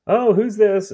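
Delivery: high, bright put-on "who's this?", playing innocent; mock delighted surprise. To grandma with the old photo, a high mock-surprised coax.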